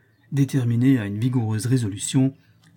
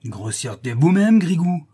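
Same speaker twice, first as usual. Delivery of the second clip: louder retort, rising at the end; snarling retort; 6 semitones higher, 5 dB louder than usual. A narrator's reading. Porter's wife returning an insult, angry rising retort. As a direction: throw the insult straight back. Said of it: "riposta Anastasie en montrant les dents".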